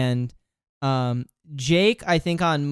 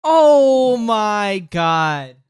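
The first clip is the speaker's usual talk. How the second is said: loud, higher, long drawn-out exclamation; amazed; 5 semitones higher, 6 dB louder, much slower than usual. Real, not acted. Podcaster learns who voices the character, loud drawn-out amazement. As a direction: stretch out every word in astonishment. Reacts to the casting reveal.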